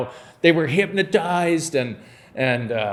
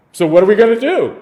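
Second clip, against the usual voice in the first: playful quick question; 5 semitones higher, 7 dB louder, faster than usual. Retold or imitated. Jesus voiced asking Philip.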